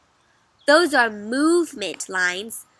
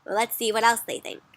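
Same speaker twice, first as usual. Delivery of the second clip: usual speaking voice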